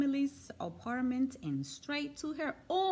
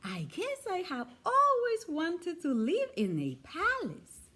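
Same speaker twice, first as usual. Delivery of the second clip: higher, wistful teddy bear voice; dreamy little bear; 6 semitones higher than usual. A narrator's reading. Toy bear voiced in story.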